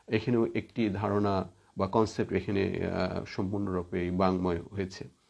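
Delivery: ordinary talk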